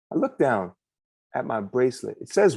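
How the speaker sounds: ordinary delivery, nothing marked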